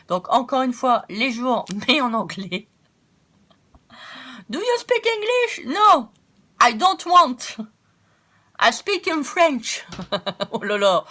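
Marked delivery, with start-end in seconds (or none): 4.5-9.9 put-on loud, high-pitched English with a heavy French accent; horrible French accent. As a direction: exaggerate a terrible French accent in English, loudly. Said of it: "Excusez-moi pour mon accent horrible". (accent français horrible, fort et aigu)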